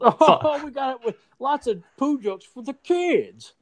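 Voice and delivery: mock-cheery pitch of poop jokes for the kids; mock executive voice. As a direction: squeak it out like a clueless studio suit. Mocking studio pitching poop jokes.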